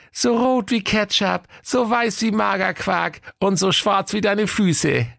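sing-song proud mother; high wife voice. Acted. Wife praising the baby to her husband, high and slow. Waltraud lists the baby's colours.

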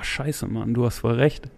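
ordinary talk

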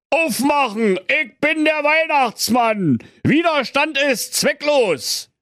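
very high, slow put-on voice; wolf faking Santa; disguised voice. A narrator's reading. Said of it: "rief mit verstellter Stimme".

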